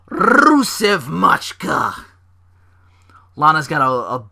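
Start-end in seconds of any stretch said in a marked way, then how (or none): 0.1-2.1 slow, drawn-out Russian-flavoured intro; mock Russian announcement. Introducing Rusev's segment in a slow mock Russian voice. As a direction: stretch the name out like a ring announcer. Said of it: "announcing segment".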